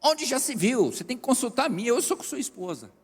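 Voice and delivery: wife's high, shrill-free indignant cry; hammed-up jealous wife; imitating the wife yelling at her husband; mock yell. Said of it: "A mulher dele grita".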